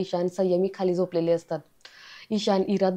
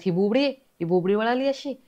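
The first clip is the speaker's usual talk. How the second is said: incredulous question; 3 semitones higher than usual. A narrator's reading. Mocking disbelief at a claimed stammer. (अविश्वासाने, सूर चढवत विचारलेला प्रश्न)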